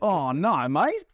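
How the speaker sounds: hammed-up Australian "no, mate"; Australian accent. Shown as a demonstration. Joking with co-hosts about Australian speech, exaggerated Australian accent. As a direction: swoop up on "mate", broad mock Australian. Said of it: "It's the only way you can do it".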